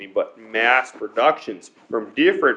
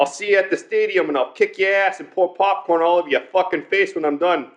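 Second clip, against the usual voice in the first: higher, rushed New York hothead; mock New York accent; 4 semitones higher than usual. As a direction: threaten like a hammed-up New York Knicks fan. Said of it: "if you don't like the fucking Knicks".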